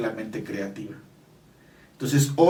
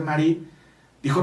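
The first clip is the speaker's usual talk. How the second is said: normal talking voice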